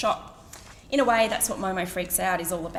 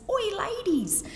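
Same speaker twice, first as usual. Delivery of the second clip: Cockney shout; 14 semitones higher, slower than usual. Retold or imitated. Pimp calling from his car in Cockney accent. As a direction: bark it like a London geezer from a car. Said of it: "It does involve an accent ... Cockney one".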